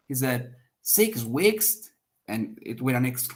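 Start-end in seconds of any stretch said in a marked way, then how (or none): 0.8-1.9 SIX WEEKS higher, rising in exclamation; emphatic repeat. Shown as a demonstration. Second speaker repeating what the first said, emphatic. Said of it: "So he's doing emphasis".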